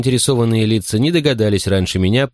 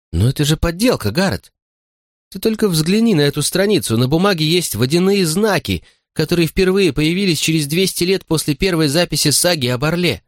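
high indignant heroine; Linda Lee upset; 9 semitones higher than usual. Acted. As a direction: protest in outrage.

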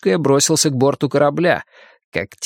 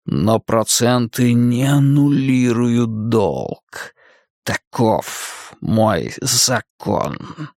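whistling voice; 2 semitones lower, much slower than usual. Acted. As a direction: let the words whistle through teeth. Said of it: "свистящим голосом сказал Мак".

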